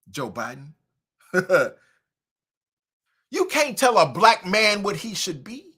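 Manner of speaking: ordinary delivery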